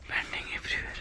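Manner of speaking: soft hushed whisper; hushed whisper inside the monastery. Real, not acted. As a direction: keep it to a breath so as not to disturb.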